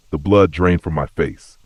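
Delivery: ordinary delivery, nothing marked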